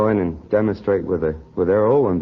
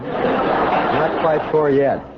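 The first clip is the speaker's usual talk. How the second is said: slow words broken by laughter; laughing; 6 semitones higher, much slower than usual. Real, not acted. Guest to host about his kids, laughing slowly. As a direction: chuckle through the confession.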